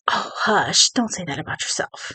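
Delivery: soft, brushing-off "hush", a touch of a shrug in it; dismissive hush. Retold or imitated. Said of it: "That's, that's not loving care".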